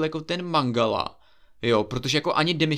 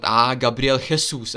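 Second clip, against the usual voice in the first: slow commentator call, "Jesus" as kheh-SOOS; mock TV commentator; 4 dB louder, slower than usual. Mocking TV sports commentators, slow commentator imitation. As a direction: imitate a commentator drawing out the name. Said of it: "tak oni pořád".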